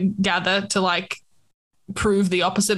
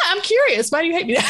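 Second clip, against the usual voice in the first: mock offended; 6 semitones higher than usual. Real, not acted. Mock offended after I hate you.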